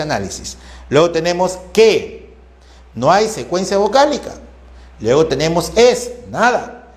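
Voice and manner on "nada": normal talking voice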